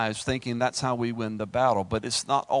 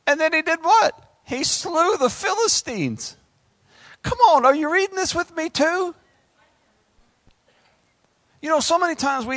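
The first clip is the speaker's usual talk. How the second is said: high, loud, strained preacher's cry; preaching at full force; 5 dB louder than usual. Acted. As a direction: rouse the crowd from the pulpit. Preacher rouses the congregation.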